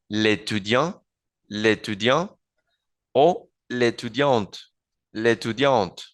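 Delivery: l'étudiant as one word, no vowel before étudiant; l'étudiante with the final T sounded; elision demo. Shown as a demonstration. Teacher showing elision in l'étudiant and l'étudiante. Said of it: "se aplica lo que se llama elision".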